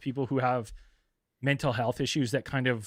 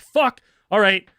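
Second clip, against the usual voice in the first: exasperated; 6 semitones higher, 9 dB louder, slower than usual. Retold or imitated. Reluctant resolve, loud.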